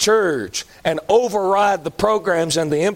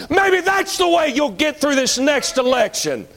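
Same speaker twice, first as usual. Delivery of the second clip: high, raised preacher's voice; fervent preaching cry; 6 semitones higher than usual. Real, not acted. Preacher reminding the church about getting through Rome, fervent cry. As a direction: ring it out high to rally the congregation.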